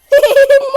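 sudden loud laugh; loud burst of laughter. Real, not acted. Lacht laut über Video.

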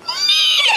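very high whinny; whinny like a horse; horse neighing. Acted. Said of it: "And a horse says".

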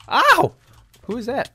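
high loud yelp then alarmed question; startled yell. Real, not acted. Player hit by a stranger, yelling in surprise. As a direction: yelp and demand who hit you.

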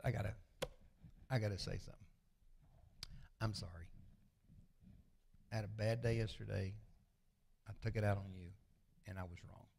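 sincere apology. Retold or imitated. Husband apologising to wife before leaving the house, softly sincere. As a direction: own up quietly to your wife.

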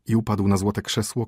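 plain reading voice

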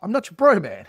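loud, high, irritated snap; annoyed rude retort. Retold or imitated. Annoyed salesman snapping at a client who calls him bro. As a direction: push back hard at being called bro. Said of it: "it sounds rude".

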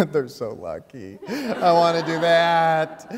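put-on loud, high, drawn-out child's whine; hammed-up wail of longing; envious whine. Girl watching her brothers play outside, envious whine.

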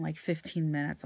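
ordinary delivery

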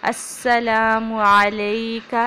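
السلام علیکہ slow and deliberate; recitation demo. Shown as a demonstration. Teacher modelling the greeting line. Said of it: "کاف کو لمبا نہیں ہونے دینا".

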